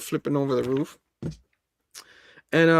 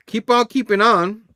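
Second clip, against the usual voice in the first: catchphrase; 6 semitones higher, 6 dB louder than usual. Shown as a demonstration. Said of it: "catchphrase repeated louder".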